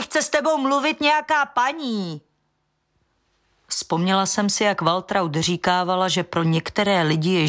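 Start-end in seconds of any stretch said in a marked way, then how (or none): 0.0-2.2 high shouted announcement into the house; girl yelling for her father; calling out. A narrator's reading. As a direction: holler it up the stairs to dad. Daughter calls father indoors.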